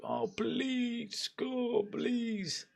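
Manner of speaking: high, soft mock pleading; pleading fans. Mimicking rival fans begging for a goal, high mock plea. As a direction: whimper it like a desperate fan. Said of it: "Liverpool fans that screw".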